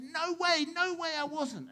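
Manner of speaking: high-pitched, insistent protest; vehement denial. Acted. Simon Peter cornered by another accuser, vehement denial.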